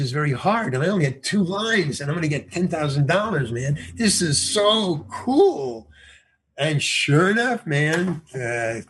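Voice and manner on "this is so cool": excited. Real, not acted. Thrilled about the money.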